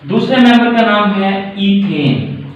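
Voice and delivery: ordinary delivery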